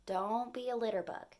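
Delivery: usual speaking voice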